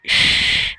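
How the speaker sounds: a soft unvoiced shush. Shown as a demonstration. Teacher showing what quiet means with a shush. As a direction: shush the class. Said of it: "This means quiet".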